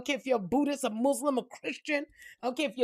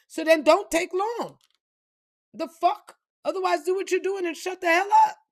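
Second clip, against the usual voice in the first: loud, higher-pitched exasperation; irritated retort; 6 semitones higher, 5 dB louder than usual. Acted. Snapping at someone apologizing in line, loud and exasperated.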